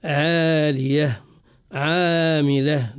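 عالية، عاملة with a light, thin ain, drawn out slowly; slow careful recitation. Shown as a demonstration. Teacher reciting the correct light ع slowly for students. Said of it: "فنرقق صوت العين من غير مبالغة".

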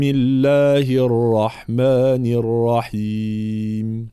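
slow melodic tilawa; Quran recitation. Acted. (تلاوة مجودة)

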